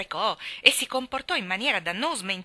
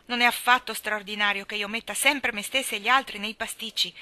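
ordinary delivery, nothing marked

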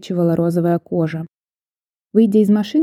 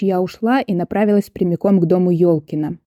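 ordinary delivery

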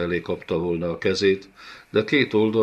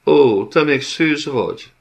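much higher, louder scornful surprise; disgusted; 8 semitones higher, 7 dB louder than usual. A narrator's reading. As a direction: sneer in disgust. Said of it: "Utálkozott a Szmirnék úr". (undorodó, gúnyos meglepetés)

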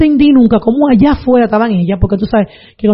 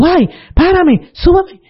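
retold burning screams; 8 semitones higher, slower than usual. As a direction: imitate grandma screaming to be lifted. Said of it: "ella está gritando porque se está quemando". (grito agudo imitado de quemazón)

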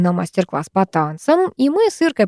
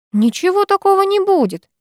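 поморщившись; 11 semitones higher than usual. A narrator's reading. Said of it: "поморщилась я".

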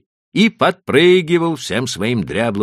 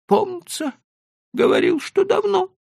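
much higher, thin, meek trader's voice; high, pleading old merchant; 4 dB louder than usual. A narrator's reading. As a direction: play the cautious Leiba, pitched way up. Leiba answers.

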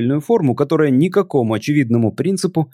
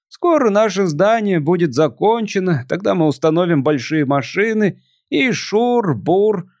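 put-on a melancholy drunk visitor; tipsy alien voice; 6 semitones higher than usual.

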